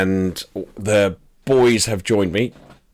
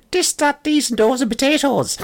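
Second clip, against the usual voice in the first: very high, hammed-up Irish brogue; mock-Irish squeak with dropped THs; hammed-up Irish accent. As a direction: exaggerate the Irish, TH turned to T and D. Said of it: "drop my T Hs and go back to".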